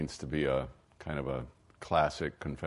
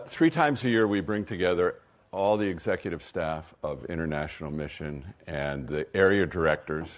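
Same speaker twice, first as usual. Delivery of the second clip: normal talking voice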